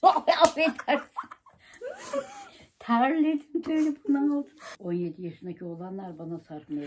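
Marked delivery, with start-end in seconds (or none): 0.0-4.5 laughing. Real, not acted. Laughing after the prank.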